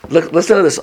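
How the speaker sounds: ordinary talk, nothing marked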